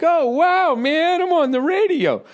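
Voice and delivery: mock thrilled radio fan; amazed seventies partygoer. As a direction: gush like a stoned partygoer. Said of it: "The guy's like".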